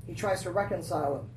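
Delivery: normal talking voice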